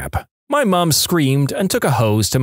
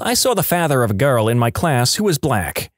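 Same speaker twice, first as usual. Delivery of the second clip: ordinary delivery, nothing marked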